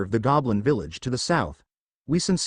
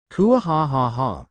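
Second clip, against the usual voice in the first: slow, hammed-up evil cackle; a drawn-out gloating mock-villain laugh; dragon's smug triumphant laugh; villainous laugh; slower than usual.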